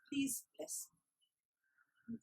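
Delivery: very slow, whispered plea; humble whisper. A narrator's reading. Snake bowing to the master, slow humble whisper. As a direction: beg softly, bowed down.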